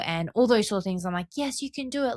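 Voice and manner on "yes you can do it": bright high pep-talk push, a touch softer; passionate cheerleading. Retold or imitated. To a struggling friend, a mild passionate pep talk. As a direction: cheer her on like a hype coach. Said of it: "being so passionate and uplifting".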